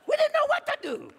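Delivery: very high, loud laughing cry; comic helplessness to the congregation; comic exasperation. Real, not acted. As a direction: throw up your hands laughing. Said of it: "comic exasperation".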